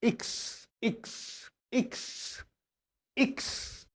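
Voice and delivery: इक्स breathed, voiceless; German X whispered. Shown as a demonstration. Teacher whispers इक्स to students. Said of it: "इसको बोलते हैं इक्स".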